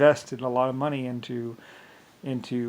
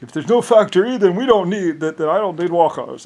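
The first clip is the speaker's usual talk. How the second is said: higher, louder mock dictator voice; 6 semitones higher, 9 dB louder than usual. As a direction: parody a cruel ruler's logic.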